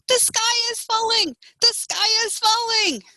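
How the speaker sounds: high-pitched alarmed chicken cry; screeching. A narrator's reading. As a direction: screech it out high, like a panicked hen. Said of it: "She popped up screeching".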